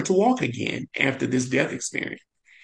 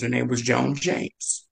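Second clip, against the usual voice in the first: usual speaking voice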